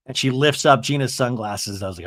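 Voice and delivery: usual speaking voice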